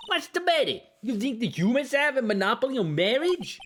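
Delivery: high, offended puppet; high huffy puppet retort; indignant puppet voice. Acted.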